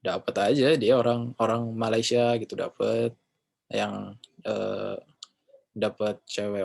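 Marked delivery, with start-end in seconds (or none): none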